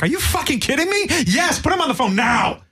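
high-pitched mock yell, no real volume; mock yelled text. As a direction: act out an all-caps text reply. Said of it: "That was like a yell text".

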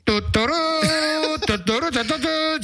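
high, slow bugle call hummed; trumpet call imitation. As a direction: toot it like a scout bugle. Scout trumpet call sound.

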